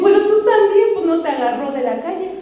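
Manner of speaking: retorting in disbelief; answering the girl in high disbelief; incredulous retort. Retold or imitated. Incredulous reply after a laugh.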